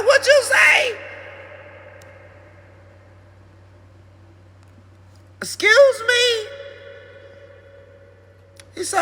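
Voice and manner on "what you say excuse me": much higher, loud, slow indignation; stunned and offended; outraged disbelief. Retold or imitated. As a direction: owner outraged by the dog's sass. Outraged reaction to dog.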